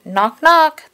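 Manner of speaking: high, loud, two beats; playful knocking. A narrator's reading. Reader acting the knock at Corduroy's door, playful. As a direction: rap the words out brightly.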